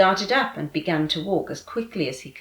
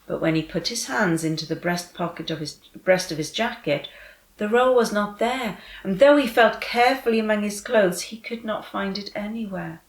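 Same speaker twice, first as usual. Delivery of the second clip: usual speaking voice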